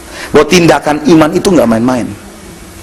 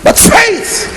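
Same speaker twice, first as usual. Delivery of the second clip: much higher, lifted "But faith"; rising emphasis. Real, not acted. Preacher lifting the point of faith. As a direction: lift the congregation. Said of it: "sermon climax".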